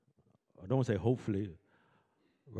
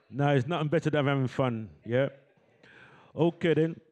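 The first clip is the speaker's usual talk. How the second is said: usual speaking voice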